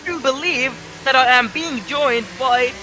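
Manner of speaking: upbeat live race call; motorsport commentator. Acted.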